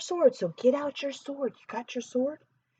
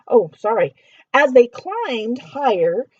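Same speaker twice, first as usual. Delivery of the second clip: usual speaking voice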